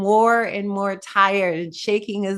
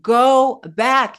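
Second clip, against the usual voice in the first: loud, higher push on Go back; mild louder eagle command; even louder command; 4 semitones higher, 6 dB louder than usual. Acted. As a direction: make the eagle's order ring out stronger. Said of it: "with the even louder voice".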